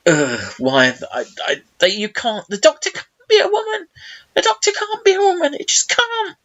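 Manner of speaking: mock exasperated mum, climbing very high; protesting mum. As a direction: imitate a mum's squeaky, high-pitched objection. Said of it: "my mum was so, like".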